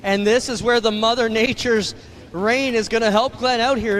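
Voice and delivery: ordinary talk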